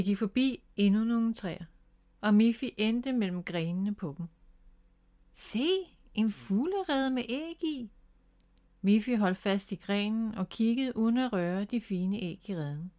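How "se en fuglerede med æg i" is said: high, wondering little voice; delighted discovery. A narrator's reading. Miffy spots a nest in the tree, delighted. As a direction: point it out with wonder.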